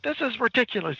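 high-pitched indignant protest; mock exasperation. Podcaster as Freddie quarrelling with Francine, mock exasperated. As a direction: protest in disbelief, acted out.

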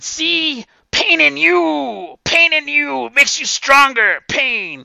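over-the-top drill coach; crazed coach yell. Retold or imitated. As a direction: scream pain into them like a mad coach. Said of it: "he said".

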